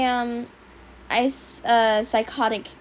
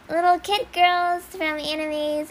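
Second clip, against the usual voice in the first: put-on little anime girl; 7 semitones higher, 4 dB louder than usual. Shown as a demonstration. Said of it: "I can do".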